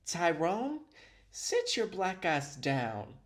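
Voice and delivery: lightly mocked scolding, drawn-out ending; a hint of a staged scolding; mimicking the clip. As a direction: imitate her telling someone off, slowly. Mimicking the clip she is reviewing.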